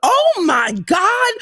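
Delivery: startled cry. Retold or imitated. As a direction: let it burst out in alarm. Sees a stranded car.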